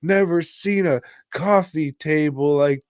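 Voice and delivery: high, hammed-up amazed baby; baby's voice. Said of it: "And the baby's like".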